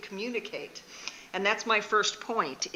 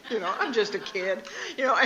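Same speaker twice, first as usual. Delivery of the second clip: ordinary talk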